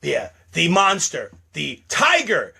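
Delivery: ordinary delivery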